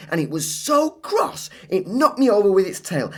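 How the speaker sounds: put-on high, excited child telling a wild story; Claire's tall tale. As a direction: spin the tale breathlessly.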